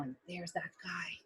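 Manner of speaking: hushed conspiratorial whisper. Acted. Narrator whispering to kids about the thief. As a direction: whisper it like a secret.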